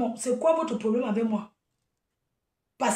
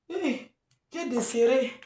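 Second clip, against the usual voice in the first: ordinary talk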